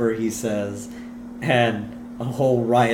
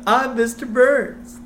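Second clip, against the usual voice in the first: high, slow mocking impression; Homer doing Mister Burns; 12 semitones higher, 4 dB louder, slower than usual. Comic mocking his boss on stage, silly high voice. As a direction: stretch it out in a silly put-on voice.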